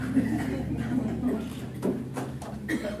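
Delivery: soft amused laugh. Real, not acted. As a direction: chuckle at your own joke. Laughs at own joke.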